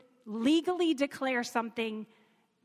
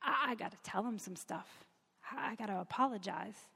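softer, hesitant, stumbling on the first word; a little fearful; 3 semitones lower, 7 dB quieter than usual. Retold or imitated. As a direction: voice Peter's reluctance with a touch of fear. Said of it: "he's still a little fearful".

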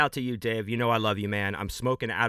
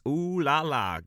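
higher, teasing swoon; playfully flirtatious, pitched up; playful ooh-la-la; 5 semitones higher, slower than usual. Pretend. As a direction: coo it with mock allure. Playful reaction to a listener's suggestive name.

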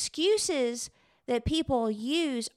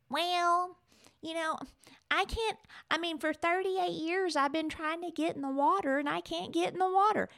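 higher, softer whining complainer; whiny voice; 5 semitones higher, 4 dB quieter than usual. Retold or imitated. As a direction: whine the excuses like a self-pitying man. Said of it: "I'm gonna put the whiny voice on".

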